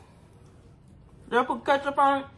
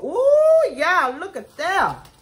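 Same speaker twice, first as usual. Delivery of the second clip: excited ooh; 6 semitones higher, 4 dB louder, slower than usual. Real, not acted. First look at the food.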